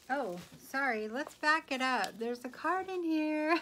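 surprised discovery. Real, not acted. Vlogger spotting a hidden card in the package, surprised. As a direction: catch yourself, then exclaim about the card.

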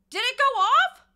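sudden high, loud question; startled, check if it went off; surprised shout. Real, not acted. Streamer asking chat if notifications fired, surprised.

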